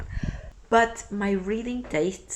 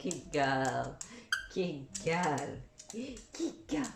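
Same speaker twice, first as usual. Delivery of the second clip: dog praise; 5 semitones lower, 8 dB quieter, much slower than usual. Real, not acted.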